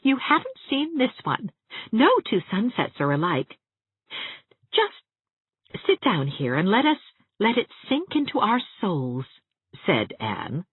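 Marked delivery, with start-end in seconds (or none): 0.0-9.3 eager. A narrator's reading. Anne urging Catherine to share sunset.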